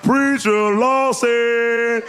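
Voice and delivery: high, very slow, loud name call; drawn-out call. Retold or imitated. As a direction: stretch the name out like a fan spotting him. Dude calling him out.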